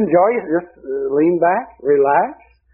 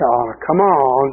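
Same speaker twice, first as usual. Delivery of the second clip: slow teasing; 3 semitones lower, much slower than usual. Retold or imitated.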